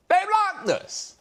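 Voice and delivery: mock outraged wail; high disbelief. Shown as a demonstration. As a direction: stretch They blocked us. up high and slow.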